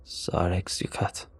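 slow, soft alien words; alien speech. Acted. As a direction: say it slowly and softly, as a strange tongue. Alien speaks its own language.

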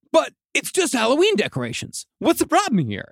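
high-pitched baffled protest; mock indignant homeowner; protesting innocence.

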